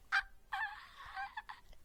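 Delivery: high, small, strangled dream yelps; a failed scream with no power; stifled high-pitched cries; weak dream scream. Retold or imitated. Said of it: "try to scream in a dream".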